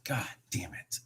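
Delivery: a bitter curse whispered to yourself; quiet whispered frustration. Real, not acted. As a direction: breathe the curse out, no voice. Curses quietly at losing trade.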